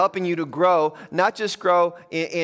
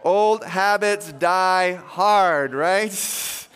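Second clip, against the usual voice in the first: slow punchline; much slower than usual. Real, not acted. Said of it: "punchline drawn out slowly".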